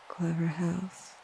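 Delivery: a possessed voice murmuring to nobody; 1 soft, slow line. Acted. The thing inside the brother speaks slowly and softly to nobody. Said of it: "it said to no one in particular".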